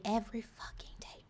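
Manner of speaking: day, every fucking day whispered under breath; whispered aside. Acted. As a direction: slip it in as a secret whisper. Aside on daily motivation.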